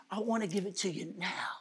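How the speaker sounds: hushed, low fatherly reply; gentle insistence. Retold or imitated. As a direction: drop to a soft, tender hush. Father gently answers dying daughter.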